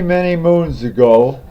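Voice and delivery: plain reading voice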